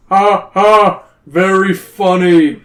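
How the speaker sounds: loud, slow mirthless royal laugh; bellowing. A narrator's reading. Cave king mocks Zoe's request, slow loud bellow. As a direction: bellow it word by word, scornfully. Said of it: "bellows the king".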